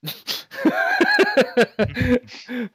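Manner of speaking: amused burst of laughter; laughing. Real, not acted. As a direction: laugh out at the joke. Laughs at the "spaghetti" answer.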